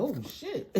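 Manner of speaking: a hammed-up yelp into a laugh; mock fright. Mocking how scared she will not be, laughing.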